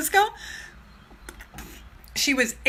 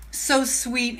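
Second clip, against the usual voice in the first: usual speaking voice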